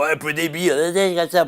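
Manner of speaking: put-on southwest French accent. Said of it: "avec un accent du sud-ouest".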